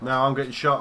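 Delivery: loud, high panic under fire; panicked shout. Real, not acted. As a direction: yell to the squad for help.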